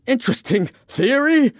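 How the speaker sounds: very high incredulous shriek; outraged shriek. Acted. Francis throws the Irishman's words back, shrieking. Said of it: "Francis shrieked".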